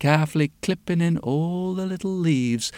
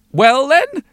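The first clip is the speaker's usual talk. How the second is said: high, loud demand; expectant old woman; 10 semitones higher, 7 dB louder than usual. A narrator's reading. Old woman demands an explanation from the witch, high and loud. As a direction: press the witch for what next. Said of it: "says the old woman".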